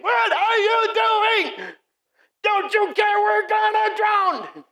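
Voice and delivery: high, drawn-out frantic yell; panicked shouting. Acted. Disciples waking Jesus in the storm, panicked shout. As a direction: shriek it in acted panic, stretching words. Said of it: "They woke up shouting".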